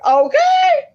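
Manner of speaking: sharp high exclaimed okay; loud okay. Real, not acted. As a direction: blurt it out, exasperated. Sudden exasperated okay.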